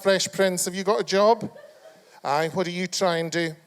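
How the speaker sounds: normal talking voice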